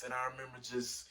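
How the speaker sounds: ordinary talk, nothing marked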